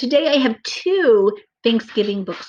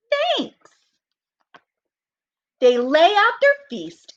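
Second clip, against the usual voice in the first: put-on slow, lively read-aloud for children; storybook reading; 9 semitones higher, slower than usual. A narrator's reading. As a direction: read it like story time for little kids. Reads story narration aloud.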